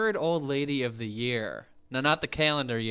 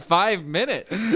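usual speaking voice

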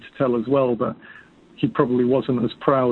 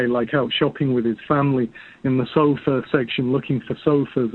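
normal talking voice

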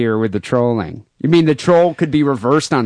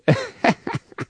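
high laugh; 4 dB quieter than usual. Real, not acted. Laughs at own joke.

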